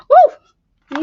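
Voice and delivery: loud high whoop; excited whoop. Real, not acted. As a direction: cheer it out big. Cheers loudly.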